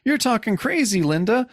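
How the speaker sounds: lightly voiced panicked old man, laughing at it; reading the fallen old man's fright. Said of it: "He's like".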